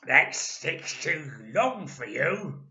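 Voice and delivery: put-on loud, slow, sneering old man; Mister Twit. A narrator's reading. Nasty old husband teasing his wife, loud and slow. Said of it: "Mister Twit said to her".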